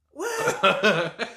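loud high laughing what; mock shocked, laughing; retold disbelief. Reacting to the accusation of hitting the gas, laughing surprise. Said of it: "I was like".

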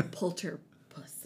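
usual speaking voice

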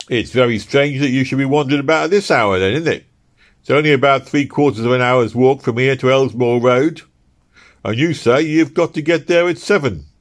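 loud, high gruff constable voice; a stern London bobby challenging him; london policeman. Acted. Policeman voice in story.

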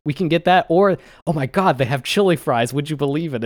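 high, loud mock enthusiasm; hammed-up imitation of an excited eater; excited gushing. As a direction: hype it up, as if amazed. Acting his excitement over chili fries.

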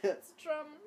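high, loud mock German accent; dumb German accent. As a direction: put on a silly German accent. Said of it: "my dumb German accent".

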